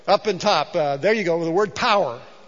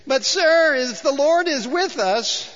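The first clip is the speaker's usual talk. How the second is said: high, slow whining plea; whiny Gideon; 7 semitones higher, slower than usual. Acted. Preacher voicing Gideon's whiny reply to the angel. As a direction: whimper it out like a coward. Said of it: "whiny, sickening kind of voice here".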